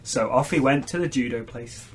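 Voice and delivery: normal talking voice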